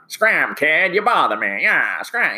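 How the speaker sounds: put-on high, gruff old-movie huckster brushing off a kid; W C Fields impression. Teacher imitating W C Fields shooing a kid away, mock gruff. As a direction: bark it out like a grumpy cigar-chomping showman. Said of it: "he'd be saying things like".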